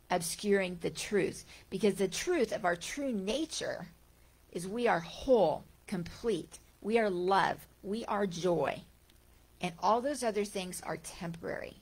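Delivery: ordinary talk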